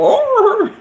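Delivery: high yelping "Oh!" like a struck dog; a hammed-up dog yelp; hit dog yelp. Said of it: "they go".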